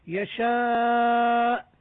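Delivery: يشاء with the long vowel held for several counts, slow; long madd. Shown as a demonstration. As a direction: stretch the aa of يشاء long before the hamza stop. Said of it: "المد العارض للسكون المنتهي بهمزة". (مد طويل في يشاء)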